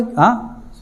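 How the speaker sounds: usual speaking voice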